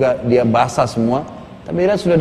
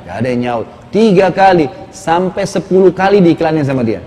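normal talking voice

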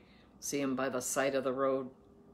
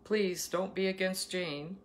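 normal talking voice

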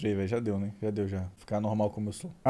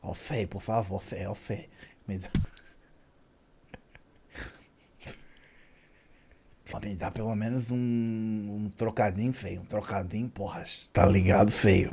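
soft, pleading street beggar asking for change; imitating a beggar; 7 semitones lower, 7 dB quieter than usual. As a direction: mumble it like a beggar at the supermarket door. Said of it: "Ele era mais ou menos assim".

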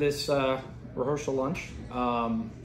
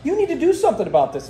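high, fast, louder nagging demand; nagging wife; 11 semitones higher, 6 dB louder, much faster than usual. Retold or imitated. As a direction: mimic the worried wife pressing him, quick and shrill. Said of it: "she goes".

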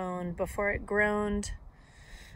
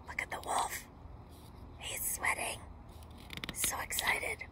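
hushed, excited whisper; whispered aside; 6 dB quieter than usual. Real, not acted. Reader pointing out the wolf to listeners, whispered aside.

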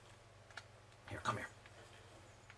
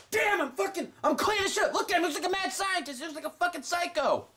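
excited shouting; 12 semitones higher than usual. Real, not acted. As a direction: shout it out, thrilled with yourself. Gamer shouting at his screen.

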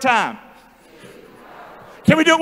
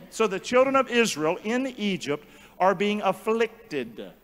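ordinary talk, nothing marked

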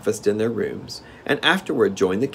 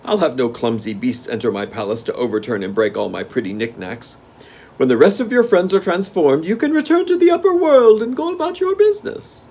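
gnome king; 9 semitones higher, 7 dB louder than usual. Acted. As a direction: relish the game like a spoiled monarch. Gnome king refusing tiger.

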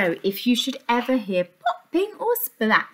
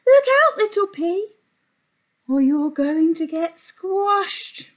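warning shout; 7 semitones higher, slower than usual. A narrator's reading. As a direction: call out the danger. Warning call to pea.

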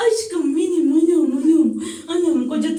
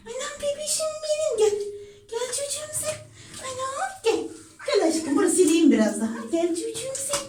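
high, hushed coaxing of a pet; high soft coaxing; 8 semitones higher, 4 dB quieter than usual. Real, not acted. Calling her cat back to be dried, high soft coax. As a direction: coax the cat over in a tiny voice.

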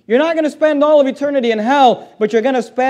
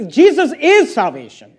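normal talking voice

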